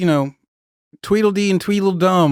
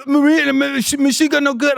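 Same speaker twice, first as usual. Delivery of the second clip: Iraqi soldier's broken English; 10 semitones higher than usual. Retold or imitated. Iraqi recruit pleading with the Marine, high, hammed-up Iraqi accent. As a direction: replay the Iraqi trainee, hammed-up, with a stammer.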